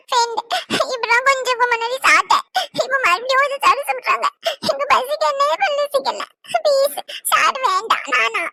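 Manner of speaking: put-on a small boy pleading not to eat, half sobbing; whining child. Adult plays whining little boy. (சாப்பிட மாட்டேன் என்று அழும் சிறுவன் குரல்)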